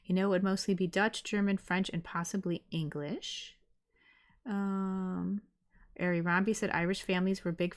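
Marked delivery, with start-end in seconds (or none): none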